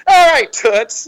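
loud, high kooky puppet voice; Lady Elaine. Retold or imitated. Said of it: "she was that kooky little puppet".

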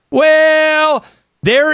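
a high, loud, drawn-out "Well"; mock official. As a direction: start the excuse like a caught bureaucrat. Mock reply from the authorities.

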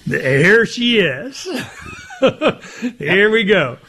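slow, laughing teasing. Real, not acted. Teasing Bob about his prediction, laughing. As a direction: tease him while laughing.

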